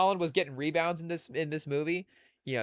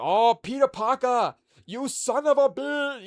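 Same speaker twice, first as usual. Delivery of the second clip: higher, louder, slow hammed-up action-movie greeting; Predator movie quote; 10 semitones higher, 6 dB louder, slower than usual. Yelena greets Peter Parker like a macho action hero, hammed up. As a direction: ham it up like the Predator handshake scene. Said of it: "the Carl Weathers handshake".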